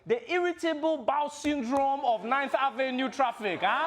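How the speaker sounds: high, emphatic Nigerian accent. Shown as a demonstration. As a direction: declaim it grandly in a Nigerian accent. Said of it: "Nigerians have the best metaphors".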